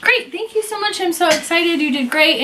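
high, overeager mock gushing; gushing thanks. To the leather guy, pretending to be thrilled, high gush. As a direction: pile on the thanks, voice way up. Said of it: "So I said".

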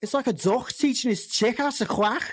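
put-on thick Liverpool Scouse accent. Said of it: "Let's give my accent one last airing".

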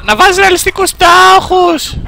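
slow, high falsetto; exaggerated high-pitched quote; mocking school advice. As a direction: drag out the advice in a mock high voice.

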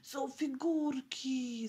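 put-on high, drawn-out child's exclamation; full-voiced call. A narrator's reading. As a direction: call it out slowly in delight. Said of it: "zawołał Piotruś pełnym głosem". (dziecięce wołanie pełnym głosem)

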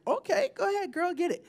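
high-pitched playful encouragement; high sing-out "you go, girl" tone; egging her on. Retold or imitated. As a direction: cheer her on in a light, high voice. Quoting her cheering reaction.

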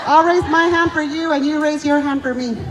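ordinary talk, nothing marked

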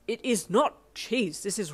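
a scandalised gourmet, loud and slow; indignant protest. A narrator's reading. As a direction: defend the cheese's honour. Ida indignant about the cheese.

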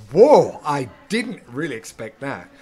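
loud, slow, startled "whoa"; acted surprise. Audience hit by left-field punchline, startled. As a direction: burst out impressed and taken aback. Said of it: "you're going".

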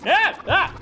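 sharp high yelps of fright; startled scream. Real, not acted. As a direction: scream at the jump scare. Jump scare screams.

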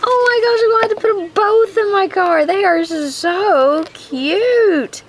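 almost crying from cuteness; near-tearful delight. Real, not acted. Overjoyed at second ornament.

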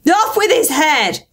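high, drawn-out royal command; acted queen's shout. A narrator's reading. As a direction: cry out the order as a furious queen. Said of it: "and shouting".

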